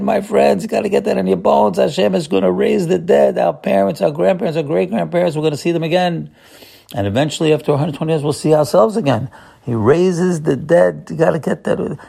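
fired-up rabbi stirring his congregation; impassioned preaching. Acted. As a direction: preach it like you believe it in your bones. Preaching on resurrection, raised voice.

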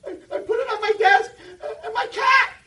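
very high, loud laughing wail; sob-laugh it like a caught little kid; laughing kid's excuse. Retold or imitated.

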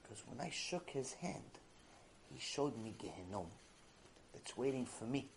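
very low, soft, subdued voice; hushed retelling. Retold or imitated. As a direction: drop almost to a whisper, as the shaken man. Calmed man retold, hushed.

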